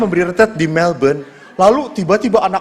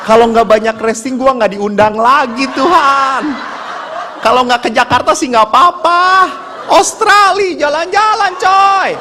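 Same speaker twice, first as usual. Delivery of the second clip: mock pleading; 5 semitones higher than usual. Priest begs God for another invitation, comic pleading. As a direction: bargain with God, pitch rising to a high cry.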